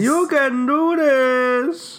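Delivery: high, slow sing-song encouragement; slow mock pep talk. Friend echoing the hype back, high and slow. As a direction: stretch it out high and slow, teasing.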